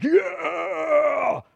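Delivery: high, drawn-out mock cheer; long pretend yell at modest volume; crowd cheer. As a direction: imitate an eager crowd yelling, held back. Crowd of prophets agrees.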